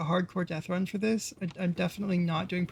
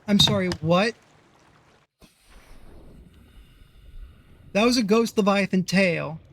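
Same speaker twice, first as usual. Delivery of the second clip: louder, sudden startled outburst; startled disbelief; 5 dB louder than usual. Real, not acted. Streamer spotting a monster where it shouldn't be, startled and loud. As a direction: react out loud to something impossible on screen. Spots a leviathan tail in game.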